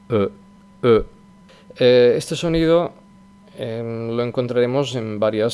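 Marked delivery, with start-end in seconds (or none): 0.1-1.0 "E" rounded, between O and E; French schwa. Shown as a demonstration. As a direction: say the "E" twice with pursed lips. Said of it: "vamos a ver si os sale el sonido". ("E" francesa, labios redondos)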